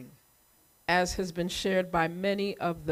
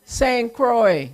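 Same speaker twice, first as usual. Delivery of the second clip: 1 rallying shout; 4 semitones higher, 9 dB louder than usual. Real, not acted. Calling out island's name to crowd.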